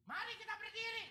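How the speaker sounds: high, bright-pitched mock call to stand; mock song leader call. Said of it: "Song leader teriak".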